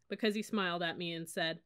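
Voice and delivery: ordinary delivery, nothing marked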